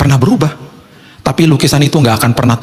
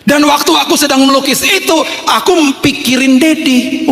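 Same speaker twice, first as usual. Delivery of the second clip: put-on high kid voice, drawn out at the end; child's voice; 13 semitones higher, slower than usual. Child says they thought of Dad while painting, high childlike voice. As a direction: explain it high, like a devoted child. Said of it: "anak saya masuk".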